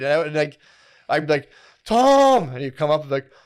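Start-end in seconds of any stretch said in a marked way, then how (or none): 1.9-2.5 high, loud call across the house. Retold or imitated. Calling his brother Tom from bed: high loud call. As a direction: yell the name to fetch him.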